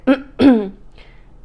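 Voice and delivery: loud throat clear. Real, not acted. Clears throat mid-sentence.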